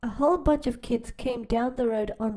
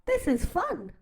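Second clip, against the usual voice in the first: happy animals; 6 semitones higher than usual. Acted. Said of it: "they all said".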